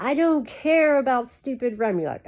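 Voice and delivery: hammed-up teenage retort; typical teenager. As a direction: imitate a fed-up daughter snapping back at dad. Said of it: "A typical teenager".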